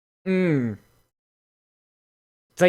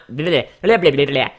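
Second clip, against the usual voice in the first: usual speaking voice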